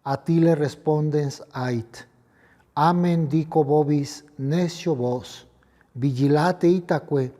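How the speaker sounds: liturgical proclamation. Acted. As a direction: proclaim it like a priest at the altar. Latin gospel proclaimed at Mass.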